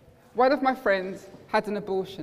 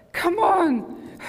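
urgent plea; 8 semitones higher than usual. Retold or imitated. Child at the dead bird willing it back, crying out. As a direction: urge it to live with a sudden cry.

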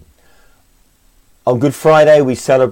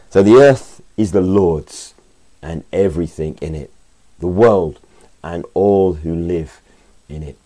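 scripture reading; 5 dB louder than usual. Acted. Reads the psalm aloud.

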